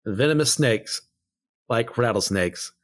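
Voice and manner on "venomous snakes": ordinary delivery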